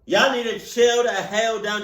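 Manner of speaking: normal talking voice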